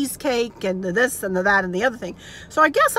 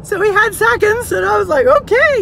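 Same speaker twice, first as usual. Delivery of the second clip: high, loud, tickled voice; amused, laughing; 10 semitones higher, 7 dB louder than usual. Real, not acted. Telling viewers dad had seconds, high and laughing. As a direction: tell it through real laughter, pitched up.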